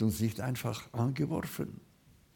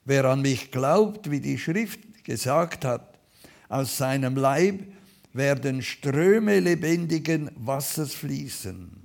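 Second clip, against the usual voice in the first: loud, ringing proclamation; crying out; 3 semitones higher, 7 dB louder than usual. Retold or imitated. Jesus calling out at the feast, loud proclamation. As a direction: call it out to the whole crowd. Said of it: "rief und sprach".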